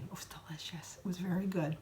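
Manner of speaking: hushed whisper about the tasty meal. Real, not acted. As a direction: breathe it out as a savouring secret.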